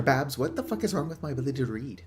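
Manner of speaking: usual speaking voice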